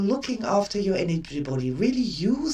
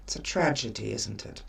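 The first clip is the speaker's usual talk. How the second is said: put-on soothing to a child; 8 semitones lower, 6 dB quieter than usual. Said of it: "did my thing".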